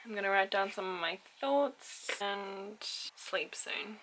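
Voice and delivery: soft, slow, downcast; hushed, sorrowful sign-off; real sadness. Real, not acted. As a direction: wind down quietly, feeling so sad. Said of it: "I'm so sad".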